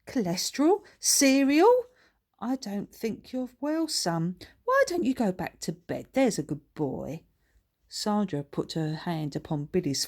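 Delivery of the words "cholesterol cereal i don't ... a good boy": higher, louder fussing mother; baffled mum. Acted. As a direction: coax him back to bed.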